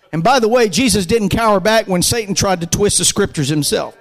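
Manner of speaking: ordinary talk, nothing marked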